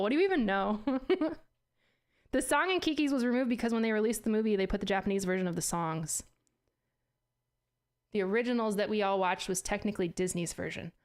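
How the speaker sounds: normal talking voice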